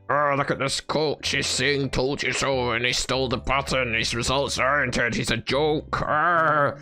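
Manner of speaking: mocking gloating critic. Critic jeering at the coach over the stolen button, mocking. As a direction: crow it like a gleeful heckler.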